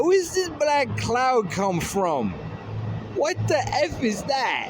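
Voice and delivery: high, slow, drawn-out amazed cry; stunned disbelief. Retold or imitated. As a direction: gape at the sky and stretch every word out.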